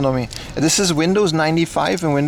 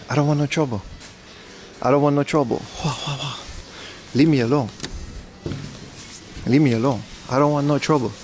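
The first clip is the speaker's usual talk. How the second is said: Jackie Chan impression; 4 dB quieter, faster than usual. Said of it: "I'm gonna do another Jackie Chan impression".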